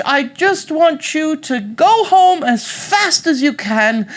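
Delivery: high, loud, slow granny protest; fake British grandmother. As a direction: fend the girl off as an ailing British granny. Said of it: "Why are you speaking with an accent".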